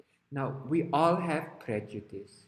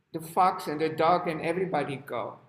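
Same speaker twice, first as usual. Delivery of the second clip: normal talking voice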